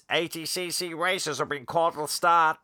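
announcer calling the racers to the start, Australian. Retold or imitated. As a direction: call the racers like a track announcer. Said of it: "on the P A system".

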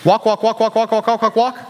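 quick run of identical syllables; playful string of walks, no gaps; rapid-fire repetition. Acted. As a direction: rattle it off in a joking chant. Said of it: "Kinda sounds like a Chinese restaurant".

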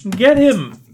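high, loud attack cry; pursuer's shout. Acted. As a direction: yell to set the pack on him. Game master voices pursuers shouting.

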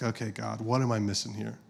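soft, subdued question to God; paused, hushed prayer. Retold or imitated. Frustrated dad pausing to ask God, hushed. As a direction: step back and ask quietly. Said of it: "I paused".

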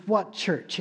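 usual speaking voice

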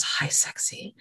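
whispered hi sexy, seductive; sultry whisper to her gun; breathy whisper. Retold or imitated. Winona greets gun sultrily.

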